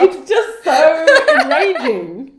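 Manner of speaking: cracking up mid-sentence; barely holding back laughter; laughing while reading. Real, not acted. Reading bad fiction, laughing.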